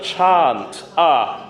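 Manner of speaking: "chant" said chahnt, then the bare Ah; ah sound. Shown as a demonstration. Choir leader repeating the wanted vowel, ah sound. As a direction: open "chant" into a long ah. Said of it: "with an ah sound".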